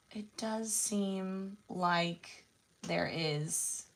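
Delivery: ordinary talk, nothing marked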